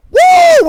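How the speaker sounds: loud, high sustained "woo"; excited whoop. Retold or imitated. Passenger raving to girlfriend about Steve, mock excited whoop. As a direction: let out a big high whoop.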